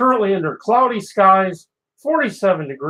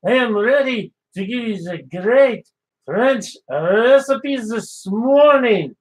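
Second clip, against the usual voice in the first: put-on French chef accent; 3 semitones higher than usual. French chef greeting Dave on the morning show, in a French accent. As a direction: play the cheerful French chef, accent laid on thick.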